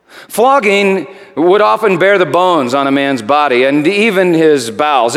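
ordinary talk, nothing marked

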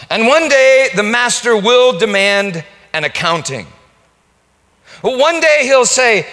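loud, high preaching cry; full-force preaching. Acted. Preacher warning the congregation of judgment, thundering. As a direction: preach it out with fire.

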